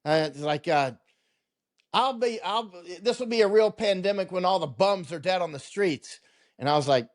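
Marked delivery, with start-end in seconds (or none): 1.9-6.2 pitched way up, mock tough Marine officer; hammed-up Marine voice, much higher; Tim the Marine infantry guy. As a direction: imitate a blunt infantry officer, high. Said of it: "He always talks like a Marine infantry guy".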